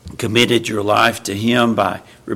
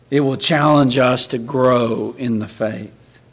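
ordinary talk, nothing marked

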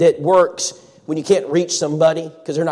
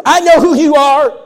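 grouchy neighbor; 11 semitones higher, 10 dB louder than usual. Retold or imitated.

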